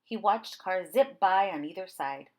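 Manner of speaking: ordinary delivery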